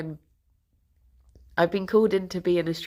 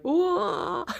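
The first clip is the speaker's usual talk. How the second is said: surprised; 10 semitones higher than usual. Retold or imitated. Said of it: "I was like".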